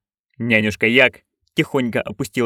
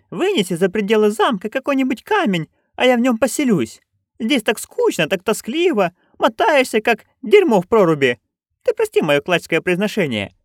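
put-on higher, eager old crone; Nanny Ogg voice; 11 semitones higher than usual. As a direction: pitch up into a gleeful old busybody. Nanny quotes the ghost. (высокий азартный голос старой ведьмы)

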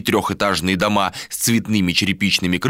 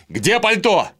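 high, urgent call from another room; shouted question; 10 semitones higher, slower than usual. A narrator's reading. Vilar calling from the wardrobe, shouted demand. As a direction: call it out at a raised pitch. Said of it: "прокричал оттуда".

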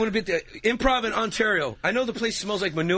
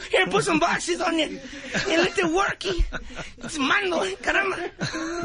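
Mando; 12 semitones higher, slower than usual. Acted. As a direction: squeal it as a comic Chicano worker. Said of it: "Mando's a Chula Vista".

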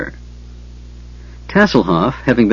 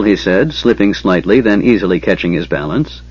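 usual speaking voice

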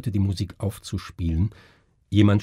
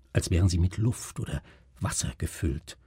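plain reading voice

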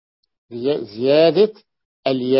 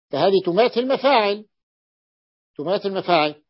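usual speaking voice

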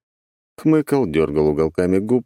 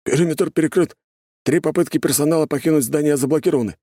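soldier's report; 9 semitones higher than usual. Acted. Guard reports.

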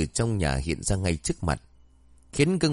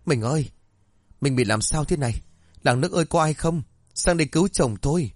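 screaming; 6 semitones higher than usual. A narrator's reading. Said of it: "gào thét".

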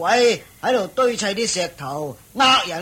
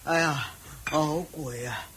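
weary, drained groans, much softer; tired man gives up digging, worn out and sighing; exhausted; 6 semitones lower, 5 dB quieter than usual. Acted.

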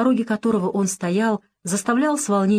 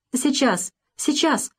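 cheerful quick reply, pitched higher; 7 semitones higher, slower than usual. Acted. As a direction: answer brightly, already hurrying. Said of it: "весело ответила мать". (весело, высоким голосом)

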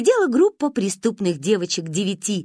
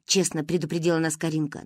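usual speaking voice

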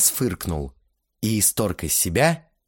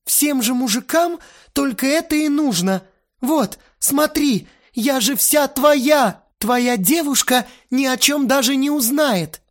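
high, slowed, pressing offer; insistent girl; 5 dB louder than usual.

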